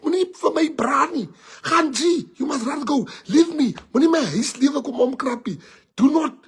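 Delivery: high tearful mock pleading; begging lovestruck friend. Friend begs teller to go and leave him, high tearful. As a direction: plead like a heartbroken fool.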